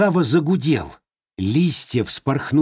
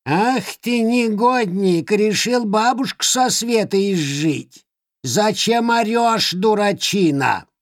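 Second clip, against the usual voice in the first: high, slow scolding crone; cackling Baba Yaga scolding; old witch voice; 10 semitones higher than usual. Acted. (сварливый голос старой ведьмы)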